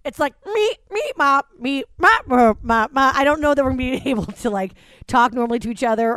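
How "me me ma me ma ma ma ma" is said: high stuttering nonsense syllables that glitch and get louder; short-circuiting babble. Retold or imitated. Mocking how people will talk after quarantine, glitching babble. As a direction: sputter like a broken robot that can't form words. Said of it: "short-circuiting".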